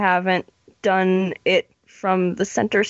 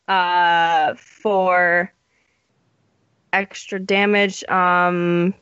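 usual speaking voice

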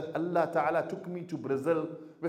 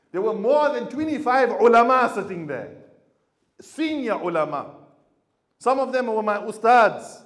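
emphatic preaching; 5 semitones higher, 8 dB louder than usual. Real, not acted. Preacher builds to a climax.

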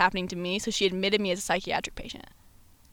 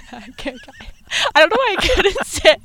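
high, loud, laughing all through; 8 semitones higher, 7 dB louder than usual. Real, not acted. Guest admitting she could name the hospital, laughing. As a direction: crack up while getting it out.

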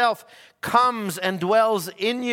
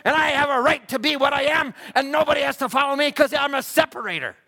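full-force preaching; 6 semitones higher than usual. Acted. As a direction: preach it out, building to a proud near-shout.

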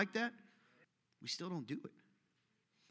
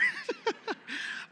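sudden high-pitched laugh. Real, not acted. Pastor teasing a boy in the crowd, high laugh. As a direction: burst out in a squeaky laugh.

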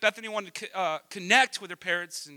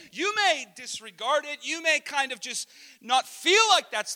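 raised, high, impassioned preaching; too loud, passionate; 7 semitones higher, 3 dB louder than usual. Real, not acted. Preacher applying the story to today, passionately loud. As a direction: drive the point home at full voice. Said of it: "am I too loud".

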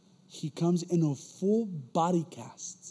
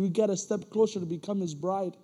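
loud, high fervent sermon delivery, louder and higher; preaching; 5 semitones higher, 7 dB louder than usual. Acted. Preacher exhorting the church about being formed, loud. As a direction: drive it home like a pastor building up.

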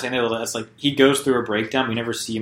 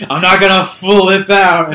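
loud, high, drawn-out mock outburst; Tom Cruise; 8 semitones higher, 11 dB louder, slower than usual. Retelling the film's best part, mock outburst. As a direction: imitate an overwrought movie hero, slow and loud.

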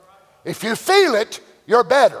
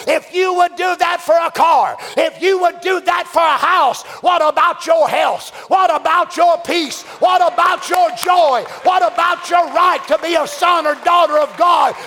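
fiery revival preacher building; preaching at full force; 7 semitones higher than usual. Acted. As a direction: hammer each question home.

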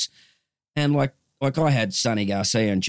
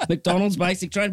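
high, real laugh through the words; amused, pitched well up with laughter; 10 semitones higher than usual. Real, not acted. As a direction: repeat your punchline while cracking up. Laughing at own punchline.

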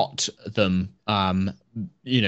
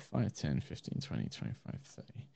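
muttering; 7 semitones lower, 17 dB quieter than usual. Real, not acted. GM counting under breath.

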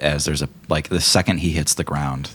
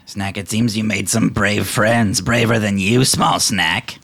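slow mocking kobold; 4 semitones higher, 3 dB louder, slower than usual. Grey Gut mocks Snack.

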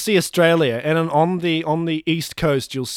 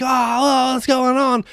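mock alarm; 9 semitones higher, slower than usual. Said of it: "everyone was like".

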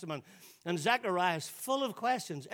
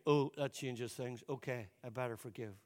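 grudging realisation; 5 semitones lower, 5 dB quieter than usual. Acted. Believer backing down before God, grudgingly. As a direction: give in quietly, dropping lower and softer.

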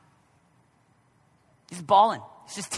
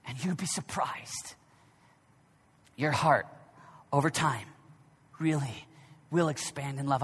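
low and much softer, slowing down; hushed preaching; 6 semitones lower, 6 dB quieter than usual. Acted. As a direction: lean in and let it land gently. Sudden drop to intimate preaching.

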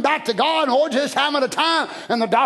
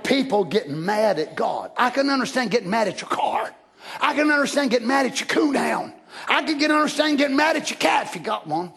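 ordinary talk, nothing marked

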